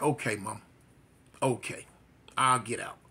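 low, soft giving in; resigned, retold. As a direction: quietly accept being sent away. Son gives in to mother.

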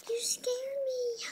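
high, slow startled cry. Pretend. Sleepy kid woken by a yell, startled cry. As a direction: jump out of sleep with a yelp.

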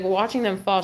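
ordinary delivery

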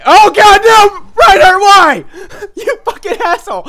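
high, loud mock-anguished cry; anguished shout. Real, not acted. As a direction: yell in despair at the teammate. Reacting to Reinhardt's blunder.

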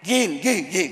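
low mocking repetition; mocking echo. Master parroting his pupil's excuse, mocking echo. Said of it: "Hỏi tới mày là mày nói ghiền".

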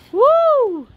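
high loud cheer; excited whoop. Real, not acted. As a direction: whoop with joy. Cheer at the reveal.

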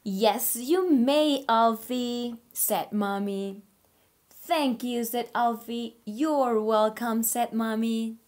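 put-on reading to children. Teacher reading a story to her online class, animated. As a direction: read aloud like a picture book for little kids. Reads picture book aloud.

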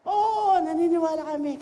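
shouted chant of belief; crowd shouting. Retold or imitated. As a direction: yell it out like an excited crowd. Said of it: "sumisigaw na".

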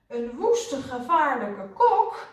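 high, loud, drawn-out emphasis; angry. A narrator's reading. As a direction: hammer out the outrage word by word. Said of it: "directeur boos". (boos en verontwaardigd)

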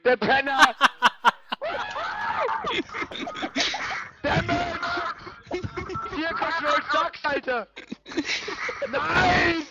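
high, cackling game rage; manic laughing yells. Real, not acted. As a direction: laugh and yell at the screen in a frenzy. Gaming frenzy, laughing and yelling.

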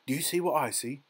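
plain reading voice

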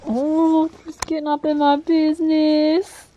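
high, loud teasing voice; playful high voice. Real, not acted. Owner teasing the cat climbing onto her, high playful voice. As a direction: tease the nosy cat.

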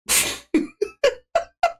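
high laugh in disbelief; incredulous laugh. Real, not acted. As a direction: laugh at how absurd it is. Reacting to the manager's reply.

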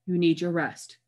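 ordinary delivery, nothing marked

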